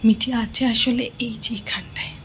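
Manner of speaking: secretive hiding whisper. Real, not acted. Hiding, commentary to camera.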